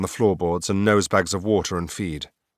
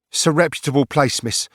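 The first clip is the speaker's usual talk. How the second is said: lightly voiced stable boy; 6 semitones higher than usual.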